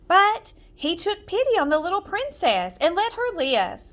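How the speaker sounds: ordinary delivery, nothing marked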